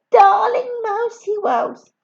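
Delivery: high, loud, adoring darling; gushing fiancée. Acted. Helen gushing to her fiancé about flowers, high and adoring. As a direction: coo it at your sweetheart.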